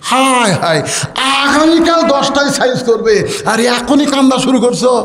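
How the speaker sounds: high-pitched acted dismay; imitated wife's alarm; exclaiming wife. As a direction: cry out in scolding disbelief.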